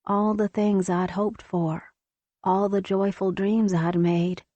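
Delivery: usual speaking voice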